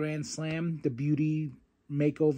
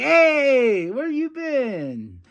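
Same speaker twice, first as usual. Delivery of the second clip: excited greeting; 9 semitones higher, 4 dB louder, much slower than usual. Retold or imitated. Greeting Willow on her return.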